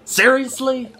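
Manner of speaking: exasperated seriously. Real, not acted. Exasperated at the game.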